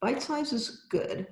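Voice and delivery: ordinary talk, nothing marked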